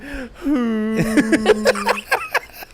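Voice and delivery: long high wail like a revving supercar; drawn-out imitation sob, engine-like; mock crying wail. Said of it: "Woman cries like a supercar".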